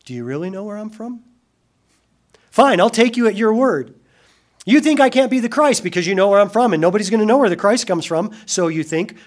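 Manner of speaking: loud, high, fast challenge to the crowd; challenging paraphrase. Acted. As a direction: press them with each question. Preacher voicing Jesus' challenge.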